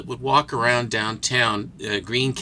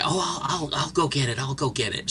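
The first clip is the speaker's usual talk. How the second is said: meek placating man; 3 semitones higher, 5 dB quieter than usual. Retold or imitated. Reedy guy placating.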